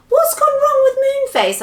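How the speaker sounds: very high, loud anxious question; worried, high-pitched voice. Acted. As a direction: ask in squeaky alarm. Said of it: "asked Silky anxiously".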